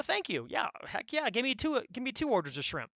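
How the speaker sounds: eager thanks. Retold or imitated. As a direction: gush happily over the extra shrimp. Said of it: "You go".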